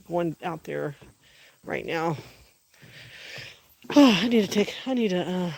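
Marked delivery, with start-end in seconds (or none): 3.9-5.5 winded after the walk outside; puffing, catching your breath between words. Real, not acted. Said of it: "catch my breath".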